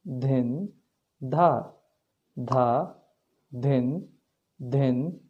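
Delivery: slow, measured bol recitation, one syllable at a time; recited tabla bols. Shown as a demonstration. Teacher reciting teen taal bols to students, steady and measured. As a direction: count out each bol evenly for the student.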